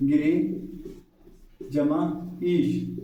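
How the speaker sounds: गिरी then जमा ईश stretched out; slow split. Shown as a demonstration. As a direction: spell out "गिरी जमा ईश" slowly. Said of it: "है ना?". ("गिरी ... जमा ईश" धीरे धीरे अलग करके)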